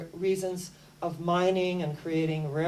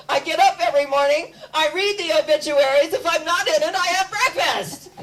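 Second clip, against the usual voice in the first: higher, louder, laughing through the punchline; George Burns joke; 8 semitones higher, 8 dB louder than usual. Retold or imitated. Lecturer quoting George Burns to her listeners, laughing. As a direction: deliver the old comic's line with a grin. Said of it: "George Burns says".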